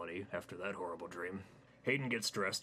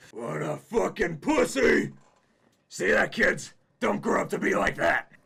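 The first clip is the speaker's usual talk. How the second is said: jeering shout; 11 semitones higher, 14 dB louder than usual. Acted. Fat man jeers to kids.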